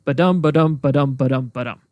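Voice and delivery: light "ba", stressed "dum", five times over; sing-song count with the weight on each "dum"; iambic rhythm demo. Shown as a demonstration. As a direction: beat out the meter evenly on "ba-dum". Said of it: "each line is five of those".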